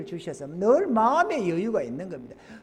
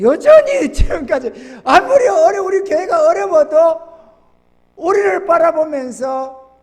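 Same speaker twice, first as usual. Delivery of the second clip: loud, slow, higher preaching build; preaching at full force; 8 semitones higher, 9 dB louder, slower than usual. Acted. Pastor rousing his congregation, loud and slow. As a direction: drive it home to the congregation.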